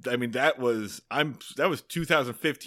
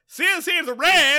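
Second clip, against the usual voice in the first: Gomer's yell; 13 semitones higher, 8 dB louder than usual. Acted. Gomer yelling after Barney's car, high holler. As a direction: holler it at full pitch. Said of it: "Well, no, I'm sorry. He yells".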